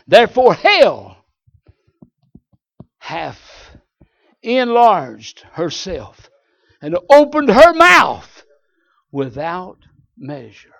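slow, swelling preacher's reading; preaching scripture. Acted. Preacher reading Isaiah to his congregation, slow and booming. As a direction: thunder it out like a pulpit reading.

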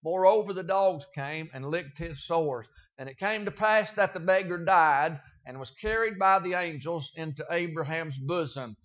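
soft, steady Bible reading; gentle pulpit Scripture reading. Acted. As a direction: read the passage quietly and reverently. Reads the Scripture passage aloud.

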